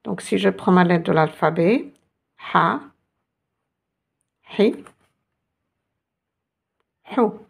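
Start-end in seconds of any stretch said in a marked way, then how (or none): 2.5-7.3 "Ha, Hi, Hou" each with a short, cut-off vowel. Shown as a demonstration. Teacher shows the letter's short vowels, "Ha, Hi, Hou" said short. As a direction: say "Ha, Hi, Hou" as single short syllables. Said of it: "avec les voyelles courtes".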